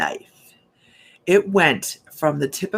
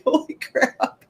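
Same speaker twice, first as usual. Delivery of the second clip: breathless exclamation; 6 semitones higher, slower than usual. Retold or imitated. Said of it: "I was like".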